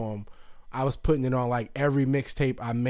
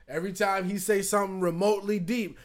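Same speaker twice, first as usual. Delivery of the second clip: normal talking voice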